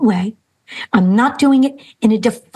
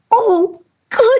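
put-on high squeaky kid's cheer; excited little boy; 12 semitones higher than usual. Boy inside him cheering at drawing time, high and excited. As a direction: burst out high like a thrilled child. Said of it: "he's like".

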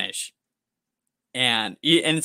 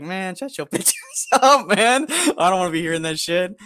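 a mock snap at the teenage coworkers, louder; telling the bossy teens to shut up with annoyed scorn; retold irritation; 6 semitones higher, 4 dB louder than usual. Venting to the podcast co-host about bossy coworkers, mock angry retort. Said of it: "And it's like".

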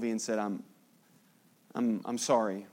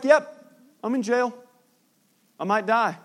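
high, cheerful, shrugging off jail; breezy, as if prison is nothing; happy Paul; 7 semitones higher, 4 dB louder than usual. Retold or imitated. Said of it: "He's, like, all happy and stuff".

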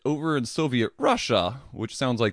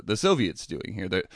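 ordinary delivery

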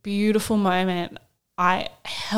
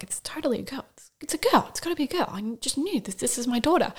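high soft excited whisper; whispered inner thought; 5 semitones higher, 6 dB quieter, faster than usual. Retold or imitated. New mother silently sure her baby is a girl, high whisper.

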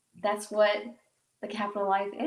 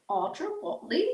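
put-on British accent; 6 semitones higher than usual. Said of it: "I have to say it in, like, a British accent".

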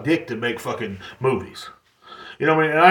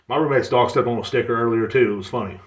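normal talking voice

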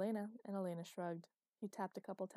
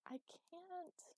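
muffled; 11 semitones higher, 6 dB quieter than usual. A narrator's reading. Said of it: "covering her head".

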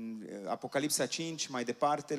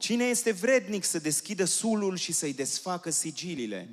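louder, higher ringing proclamation; preaching scripture; 4 semitones higher, 7 dB louder than usual. Acted. Interpreter proclaiming the verses to the congregation, loud and ringing. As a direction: lift the verses over the crowd.

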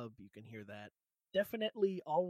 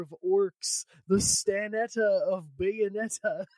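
hyped intro; 4 semitones higher, 9 dB louder, slower than usual. Acted. Playful announcer intro.

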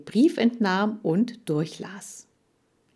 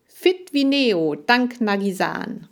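high, loud, slow slogan call; advertising slogan; 4 semitones higher, 5 dB louder than usual. A narrator's reading. Hamster imagines his future advert, proud slogan call. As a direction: proclaim it like a drawn-out ad jingle line. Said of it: "Bald würde der Werbeslogan lauten".

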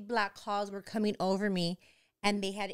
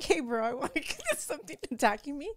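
higher, laughing put-on voice as a bro calling for help; mock 911 caller; 6 semitones higher than usual. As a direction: joke about phoning in a demon attack, laughing. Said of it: "call nine one one and be like".

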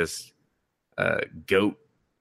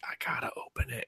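a fast, breathy whisper; character whispering he must open it; whispered compulsion; 13 dB quieter, faster than usual. Retold or imitated. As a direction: hiss it like a guilty secret. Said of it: "And he was just like".

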